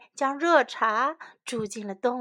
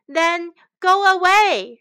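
loud, raised-pitch dismissal; angry shout; 6 semitones higher, 9 dB louder than usual. Acted. Shen sends the beggar away, shouting. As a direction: shout to drive someone off. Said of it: "shouted Shen".

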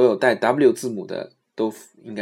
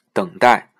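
ordinary delivery

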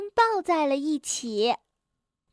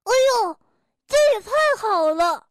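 loud thrilled doctor; delighted; 7 semitones higher, 5 dB louder, faster than usual. A narrator's reading. The doctor is thrilled to see the broken tooth, delighted. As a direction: gush over a broken tooth. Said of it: "高兴地说".

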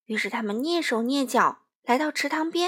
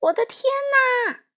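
farmer's cry; 10 semitones higher, faster than usual. Acted. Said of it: "大叫了一声".

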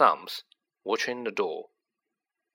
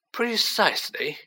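put-on old headmaster voice; 10 semitones higher than usual. A narrator's reading.

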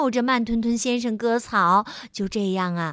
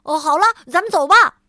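higher, much louder and fast; shouting; 6 semitones higher, 10 dB louder, faster than usual. Acted. Mr. Busy calling to leave, loud and fast. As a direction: call everyone out the door. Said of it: "他做完后喊道".

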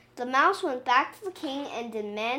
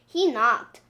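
ordinary delivery, nothing marked

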